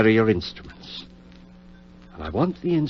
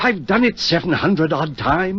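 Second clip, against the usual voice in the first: much higher, emphatic reassurance; impatient insistence; 10 semitones higher, 5 dB louder than usual. Acted. Surgeon brushing off his friend's doubts, high and emphatic. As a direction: wave away his worry.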